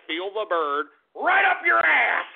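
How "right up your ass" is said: drawn-out taunting yell; slow, loud, gleeful jeer; gloating shout. Real, not acted. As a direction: shout it as a mocking jab. Gloating jab at Sanders supporters.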